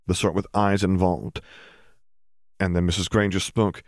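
ordinary delivery, nothing marked

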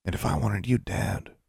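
quiet, sinister undertone; whispered menace. A narrator's reading. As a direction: murmur it as a veiled threat. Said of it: "he whispered".

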